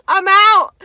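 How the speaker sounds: high, loud cheeky farewell; gleeful shout. Retold or imitated. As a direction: yell it as you bail out. Hopping fence, ditching friends.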